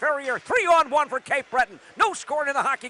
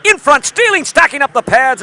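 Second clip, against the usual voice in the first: loud urgent call; 9 dB louder than usual. Real, not acted. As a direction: shout as the puck slides in front. Scramble in front of net.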